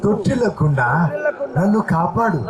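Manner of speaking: earnest pleading prayer, phrase by phrase; fervent prayer. Acted. Pastor leading the congregation's prayer aloud, fervent pleading prayer. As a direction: pray it aloud for all to follow.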